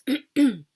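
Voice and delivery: loud acted throat-clearing; a short, sharp cough in the throat; imitated cough. Woman telling of a man passing her, imitated cough. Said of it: "and he coughs".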